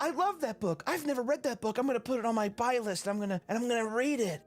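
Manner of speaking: high, gushing book-fan voice; mock gushing reader. Mocking women who gush about books in a high voice.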